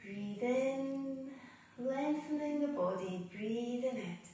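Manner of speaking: soft, unhurried yoga breathing cues with long pauses; guided meditation. Acted. Yoga teacher guiding students through Ujjayi breath, soft meditation voice.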